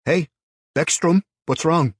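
loud, high call. A narrator's reading. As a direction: call out over the noise. Eric calling to his friend.

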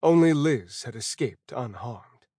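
plain reading voice

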